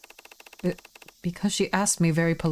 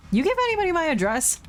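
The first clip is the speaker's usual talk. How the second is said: higher, lightly voiced young woman; Hana's voice; 10 semitones higher than usual. Hana asks Mama about her address, pitched higher. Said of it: "That's Hana".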